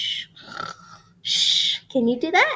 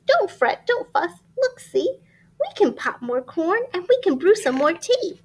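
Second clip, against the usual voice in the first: high bright mouse voice; lightly squeaky mouse reassuring; 7 semitones higher than usual. Acted. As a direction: comfort the bear in a tiny voice.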